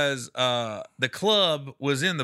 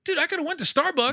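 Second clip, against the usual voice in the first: exasperated; 12 semitones higher than usual. Retold or imitated. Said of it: "I go".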